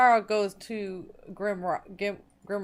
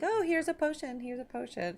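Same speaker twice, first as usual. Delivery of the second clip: high, offhand imitation of an old woman; imitated old apothecary; 6 semitones higher than usual. Player recalls the oldest resident handing out potions, imitated high voice. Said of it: "she was just kinda like".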